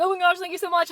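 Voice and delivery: high, loud burst of gratitude; effusive, squealed-up thank you; gushing thanks. Retold or imitated. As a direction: gush with sudden relief, acting it out.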